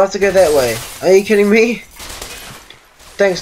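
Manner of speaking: ordinary delivery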